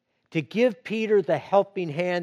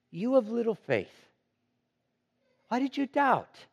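higher, gentle but searching challenge; loving rebuke; 7 semitones higher than usual. A narrator's reading. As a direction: chide him tenderly, like a caring teacher. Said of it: "the loving rebuke".

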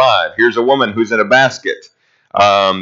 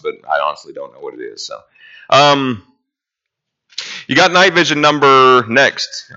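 normal talking voice

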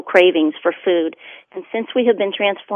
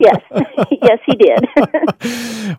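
laughing through the words; slow, giggly yes; 3 semitones higher, 7 dB louder, much slower than usual. Real, not acted. Laughing while answering host.

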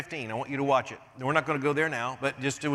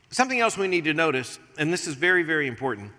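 usual speaking voice

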